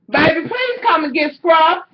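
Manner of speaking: high-pitched plea across the house; real exasperated call-out. Real, not acted. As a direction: call for help, pitched well up. Calling someone to fetch the dog.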